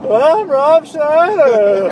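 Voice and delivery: high, loud, very slow put-on celebrity voice; Rob Schneider voice. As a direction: stretch each word out high and loud. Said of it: "that's pretty much what Rob Schneider sounds like".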